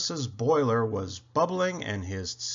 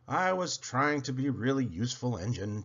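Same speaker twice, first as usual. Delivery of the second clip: usual speaking voice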